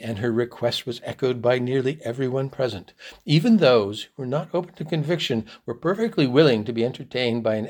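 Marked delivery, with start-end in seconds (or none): none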